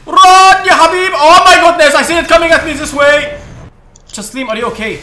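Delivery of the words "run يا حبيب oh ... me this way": loud and high alarm; urgent warning shout. Real, not acted. Player warning a teammate of incoming fire, urgent shout. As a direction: yell for them to run.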